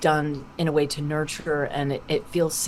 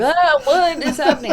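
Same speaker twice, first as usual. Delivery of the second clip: high, loud freaked-out cry; hammed-up alarm, pitched way up; mock panic; 12 semitones higher, 7 dB louder than usual. Friend freaked out by a prank call, mock-panicked. Acting out the frightened prank victim.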